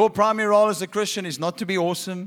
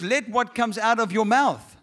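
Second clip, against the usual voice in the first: ordinary talk, nothing marked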